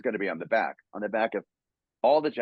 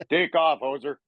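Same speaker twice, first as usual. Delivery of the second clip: a higher, joking Canadian accent; mock Canadian; 5 semitones higher, 4 dB louder than usual. Shown as a demonstration. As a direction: play a Canadian Wolverine for laughs. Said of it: "call somebody a hoser".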